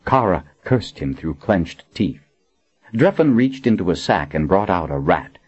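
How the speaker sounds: ordinary delivery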